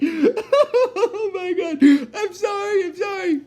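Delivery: cracking up while talking, voice shooting high; helpless giggles breaking through the words; real laughing fit. Real, not acted. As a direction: try to apologize while losing it laughing. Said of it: "I'm actually glad I'm laughing now".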